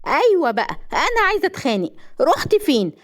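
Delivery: high-pitched angry challenge; confrontational. A narrator's reading. Wife confronting husband over whom he hurt, angry. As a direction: come at him looking for a fight.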